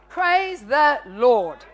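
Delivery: slow, loud praise the Lord; drawn-out praise. Real, not acted. Preacher praises God before the congregation, slow and loud. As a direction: stretch it out to the crowd.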